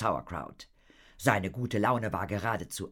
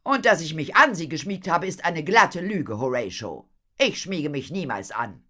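loud, raised, brisk at first; indignant denial; 8 semitones higher, 8 dB louder than usual. Acted. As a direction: firmly deny it, piqued. Madame denies his flirtatious story. (empört und laut)

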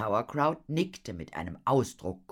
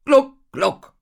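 high, loud sinking noises; mimicked glugging; 17 dB louder than usual. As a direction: glug it out mockingly. Imitates a sinking ship. (hohes, lautes Gluckgeräusch)

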